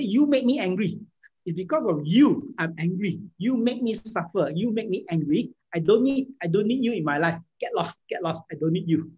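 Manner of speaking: acting out an angry partner lashing out; angry blaming. Shown as a demonstration. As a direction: throw the blame at them, heated. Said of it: "very often when we are angry".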